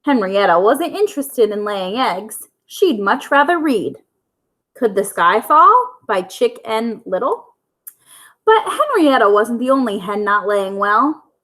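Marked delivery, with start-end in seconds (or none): none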